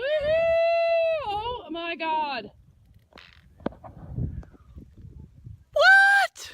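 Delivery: high loud whoop of joy; ecstatic shout. Real, not acted. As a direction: scream with delight at the view. Overwhelmed by summit view.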